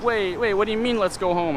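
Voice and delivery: retold alarm, voice jumping up high; mock a girl caught off guard; startled protest. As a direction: imitate her high-pitched, startled protest.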